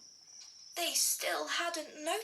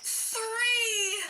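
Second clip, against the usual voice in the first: loud reveal; 8 semitones higher, 6 dB louder than usual. A narrator's reading. Climactic reveal.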